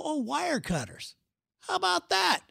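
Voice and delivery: high, sing-song eureka voice; a mock-clever lightbulb moment, high; sudden bright idea. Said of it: "So I go".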